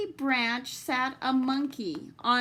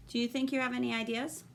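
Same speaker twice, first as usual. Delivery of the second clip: usual speaking voice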